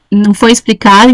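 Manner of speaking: ordinary delivery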